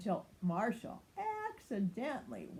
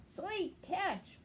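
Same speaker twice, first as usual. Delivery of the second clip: much higher cheer; 10 semitones higher than usual. A narrator's reading. Ryder cheering Marshall, much higher. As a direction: praise the catch. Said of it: "Ryder cheered".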